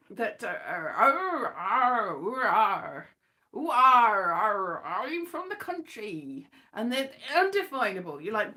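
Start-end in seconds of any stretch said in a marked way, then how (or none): 0.4-6.5 exaggerated rural English burr with drawn-out arrs; country accent. Parodying farming folk on The Archers, country accent. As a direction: ham up a yokel country accent. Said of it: "country accents".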